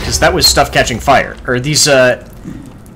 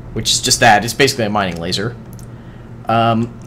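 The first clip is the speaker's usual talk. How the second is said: normal talking voice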